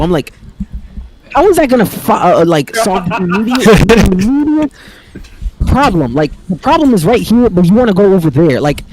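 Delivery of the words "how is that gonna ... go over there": high-pitched, incredulous retort; exasperated protest. Retold or imitated. Son questioning mom's plan, exasperated protest. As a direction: argue back in disbelief, voice raised in pitch. Arguing with mom, retold.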